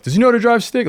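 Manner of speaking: high, loud mock disbelief; imitating incredulous dad. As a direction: quote a baffled dad, pitch jumping right up. Retells dad's incredulous question on FaceTime.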